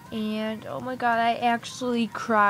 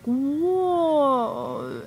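long, louder drawn-out whoa; acted shock; 4 semitones higher, 5 dB louder than usual. Imitating Claire learning about Allie and Jake, acted shock. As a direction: imitate someone stunned, stretching the word. Acting out character's reaction.

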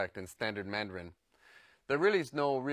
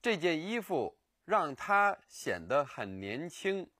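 slow; 4 semitones higher, slower than usual. Shown as a demonstration. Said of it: "Here's another example".

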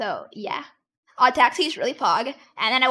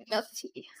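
whispering; 3 semitones lower, 10 dB quieter than usual. Real, not acted. Reading chat message aloud.

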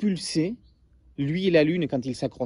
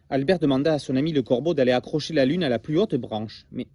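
lively storybook read-aloud; story narration; 2 semitones lower than usual. A narrator's reading. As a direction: tell it like a bedtime tale to children. Reads story to class.

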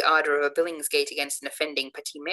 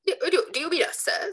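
stammering indignation; 13 semitones higher than usual. Acted. The whole tribe stammering, offended.

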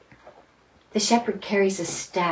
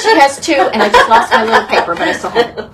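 laughing; 5 semitones higher, 9 dB louder than usual. Real, not acted. Losing her paper, laughing.

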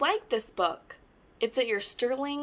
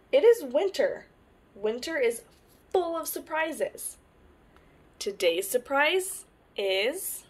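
lively picture-book read-aloud; reading to children; 2 semitones higher than usual. Acted. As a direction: tell it to little ones, brightly.

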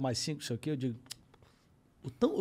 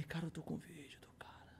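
whisper; 18 dB quieter than usual. Retold or imitated. Secret excitement about the video.

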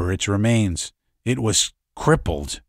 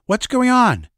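much higher, louder alarmed question; startled and uneasy, high and loud; injured daughter hearing chaos outside; apprehensive; 8 dB louder than usual. Acted. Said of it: "sat up apprehensively".

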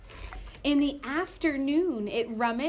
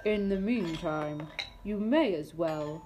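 put-on lower, calm old beetle voice; wise old beetle; 5 semitones lower, 3 dB quieter than usual. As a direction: speak like a patient old teacher.

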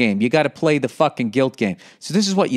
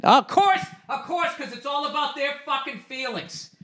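high, mocking sing-song then soft sneer; mock their hurt feelings, higher; sarcastic high-pitched mockery; mocking whine; 10 semitones higher, 6 dB quieter than usual.

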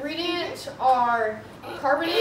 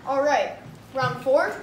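ordinary talk, nothing marked

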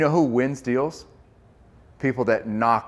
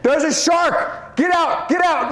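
much higher, louder shout of alarm, slow on the shark line; panicked yell; 5 dB louder, slower than usual. Retold or imitated. A swimmer yelling at the beach to get out, high panicked shout. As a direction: scream the warning at the swimmers. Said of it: "lot of yelling, screaming".

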